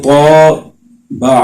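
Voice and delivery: طابع drawn out slowly, vowels stretched too long; mock mistaken long طابع; wrong stretched reading. Shown as a demonstration. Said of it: "ایسے بھی نہیں پڑھ سکتے".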